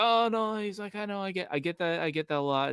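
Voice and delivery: high-pitched Scottish gym guy; imitated Scottish accent. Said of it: "coming out with his accent".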